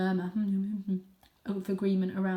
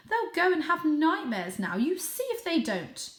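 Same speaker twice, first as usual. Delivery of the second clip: fretting mother cat; 9 semitones higher, 4 dB louder than usual. Acted.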